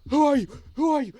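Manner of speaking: loud, high shouts of alarm; bewildered cry on waking; alarmed shouting. Acted. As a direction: demand it loudly, high and startled. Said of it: "he looks to either side and says".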